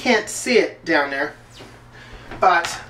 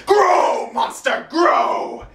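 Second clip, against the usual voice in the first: higher, rasping shouted command; villain command; 10 semitones higher, much slower than usual. Retold or imitated. Fan imitating the villain making the monster grow, raspy shout. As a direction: call out grandly like a villain enlarging his monster. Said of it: "take the thing".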